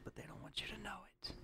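hushed, hurried whispered aside; quick mock-secretive whisper; conspiratorial whisper.

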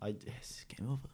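breathy whisper of the line; whispered quote. Acted. Telling her friend the chosen quote, in a whisper. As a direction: hush it out like a secret.

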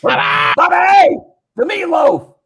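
loud, high-pitched mock holler; hammed-up yell. Host joins in calling Bobby, mock screaming. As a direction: bawl it like a demanding brat. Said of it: "He can just turn and yell".

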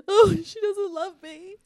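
high, slow, mock-crying whine; mock sobbing. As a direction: ham up a fake ugly cry. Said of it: "ugly cry face".

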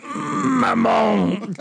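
high yelled Maman; Oliver Reed quote. Retold or imitated. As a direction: shout it like a movie scream. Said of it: "to quote Oliver Reed".

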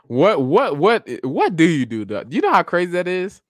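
ordinary delivery

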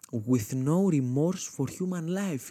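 slow, grand trailer-style delivery; mock epic narration. The comic sums up his grandma as a heartless villain, slow and grand. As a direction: lay it on like a movie trailer. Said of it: "mock epic line".

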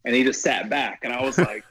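ordinary talk, nothing marked

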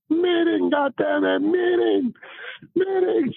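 very high retold bark calling a meeting; manager bellowing. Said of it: "Lou came out ... and said".

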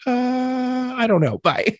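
high drawn-out stall, then quick goodbye; awkward exit. Retold or imitated. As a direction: imitate someone dodging and fleeing. Lady awkwardly flees the question.

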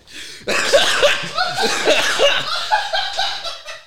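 very high, loud, long fit of laughter; helpless laughter. Real, not acted.

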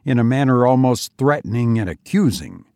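ordinary delivery

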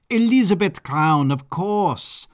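high, loud burst of recognition; delighted exclamation. Acted. Baron recognizing his famous guest, high loud exclamation. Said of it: "the Baron exclaimed".

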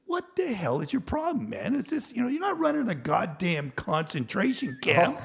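high, loud retold outburst; losing it. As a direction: replay blowing up at the foreman in exasperation. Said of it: "I just lost it".

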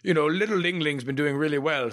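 lightly voiced probation officer pleading; mock probation officer. Probation officer pleading for little Ling Ling. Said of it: "a probation officer's like".

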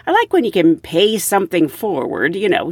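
normal talking voice

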